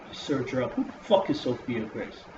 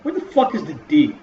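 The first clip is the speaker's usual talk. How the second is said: exasperated; 3 semitones higher, 8 dB louder than usual. Real, not acted. Ranting about misspelling.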